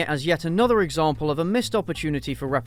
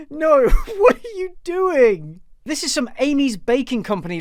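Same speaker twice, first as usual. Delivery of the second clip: real incredulous cringe; appalled laughing disbelief; 10 semitones higher, 6 dB louder than usual. Real, not acted. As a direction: laugh helplessly as you wail it. Appalled laughing reaction to the tweet.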